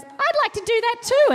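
put-on high, bratty kid voice begging to come along; whiny little sister. Little sister begging mum to tag along, whiny and high. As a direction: pipe up like a pesky younger sibling.

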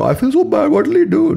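very high, whiny mock-anguish; psycho voice. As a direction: squeal it like a hammed-up fretful friend. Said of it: "why is my voice going into this fucking psycho voice".

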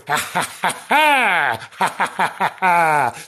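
loud hammed-up demon cackle; mock-evil staccato laughter; demonic cackle. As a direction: cackle in bursts like a demon from hell. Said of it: "cackling like a, like a demon from hell".

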